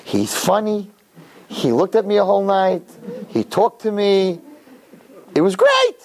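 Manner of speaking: gush, climbing to a very high finish; gushing daughter. Acted. Acts girl gushing to father.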